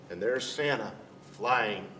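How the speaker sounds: usual speaking voice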